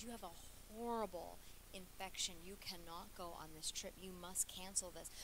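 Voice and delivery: low, hushed hint of a stern doctor. Doctor forbidding his patient the trip, lightly stern. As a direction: lightly voice a grave doctor, quiet and firm. Doctor giving a grave order.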